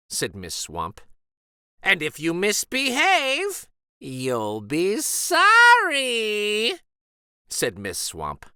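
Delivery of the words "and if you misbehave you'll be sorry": high, loud, slow drawn-out threat; mean teacher threatening the class, slow and menacing; threatening witch teacher. A narrator's reading. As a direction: stretch out the warning menacingly. Miss Swamp threatens kids.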